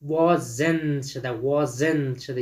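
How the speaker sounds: WASN'T slowly split as WA-ZENT; "wasn't" drawn out, both syllables given full weight; two-syllable demonstration. Shown as a demonstration. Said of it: "Wasn't has two syllable".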